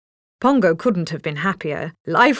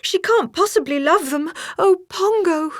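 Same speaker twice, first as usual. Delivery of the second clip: high, upset cry; alarmed mother dog cries out; frightened cry; 11 semitones higher than usual. A narrator's reading. Mother dog cries to Pongo, high and alarmed. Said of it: "cried to Pongo".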